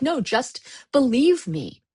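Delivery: slow, pleading, high; emphatic plea. Retold or imitated. Fed-up woman demanding to be believed, slow and high. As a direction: insist slowly that she be believed. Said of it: "It's like".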